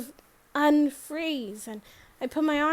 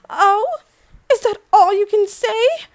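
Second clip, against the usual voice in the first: loud, high, affronted retort; indignant mother; 7 semitones higher, 9 dB louder than usual. Acted. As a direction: snap back as an offended mother. Mother gets on her case.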